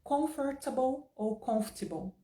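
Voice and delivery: Comfortable said slowly in two accents. Shown as a demonstration. Teacher comparing accents of comfortable. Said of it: "várias pronúncias".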